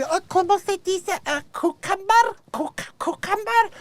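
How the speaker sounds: high, hammed-up fake Spanish accent. Comic mocking Hilaria's fake Spanish over cucumber, high.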